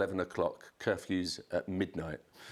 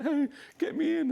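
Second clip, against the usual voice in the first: mock squeaky call; 4 dB louder than usual. Calling backstage for help.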